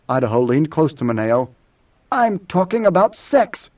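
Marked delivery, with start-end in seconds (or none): 2.1-3.7 higher, slow, insistent. Acted. Idaho leans close to Moneo to correct him, insistent. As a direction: press the point slowly in a raised pitch.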